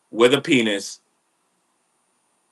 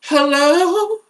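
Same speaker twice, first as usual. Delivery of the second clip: very high airy call of hello; a breathy high-pitched New Age lady calling out; woman calling up the stairs in a high wispy voice; slower than usual. Retold or imitated. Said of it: "New Age Angelica Houston".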